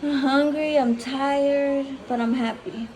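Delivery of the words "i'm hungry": ordinary delivery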